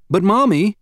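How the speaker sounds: put-on mild tearful plea from a little girl; a touch of crying child. Daughter near tears begs mom for help, high whimper. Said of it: "she started to cry".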